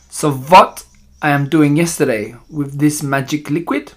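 put-on accented witch posing a question to her followers; villainess with a foreign accent, questioning rhetorically.

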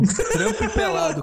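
high laughing delight. Real, not acted. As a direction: squeal it through laughter. Laughing at the news.

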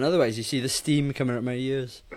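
ordinary delivery

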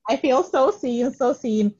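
high, delighted reenacted gush; gushing self-quote. Host retelling her reaction to being followed, gushing. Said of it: "I was like".

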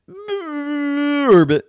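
high, loud croaked name; frog call. A narrator's reading. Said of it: "Herbert went".

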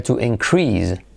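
to inCREASE, weight on the last syllable; stress on second syllable. Shown as a demonstration. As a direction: lean on CREASE in increase, as the verb. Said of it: "el verbo sería". (increase con acento en la segunda sílaba)

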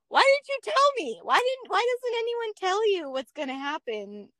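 new mom to her friends, half-joking exasperation; mock exasperation. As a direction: whine it like you were tricked. Exasperated reply to confession.

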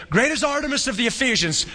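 high-pitched mock chant; crowd crying out. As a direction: imitate a crowd's cry without full volume. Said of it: "they all cried out with one voice".

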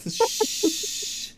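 breathy high shush; high whispered shush. Acted. Sturdy shushing the man he smothers. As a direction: hush him in a thin high whisper. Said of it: "And he's like".

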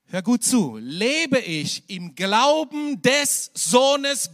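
loud, high, slow fervent preaching; preaching at full force. Acted. (Prediger ruft der Gemeinde laut und eindringlich zu)

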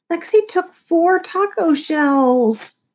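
put-on high, slow, drawn-out kid's whine; whining. A narrator's reading. Otto tattling on his sister to Mom, whining. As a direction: whine the complaint, stretching the words. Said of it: "I whined".

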